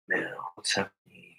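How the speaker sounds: breathy hushed aside; quick hushed whisper. Real, not acted. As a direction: whisper it like a secret. Sneaky whisper to camera.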